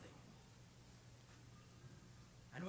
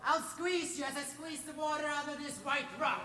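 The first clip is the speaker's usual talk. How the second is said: shouting; 6 semitones higher, 5 dB louder than usual. Acted. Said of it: "he shouted at the troll".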